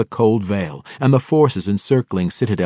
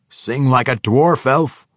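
quip; 5 semitones higher, 6 dB louder than usual. A narrator's reading. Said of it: "Bruner quipped".

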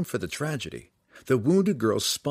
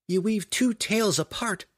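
put-on higher young woman's voice for Canterbury; Canterbury's voice; 11 semitones higher than usual. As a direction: lift into the girl's voice, doubtful. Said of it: "Canterbury replied".

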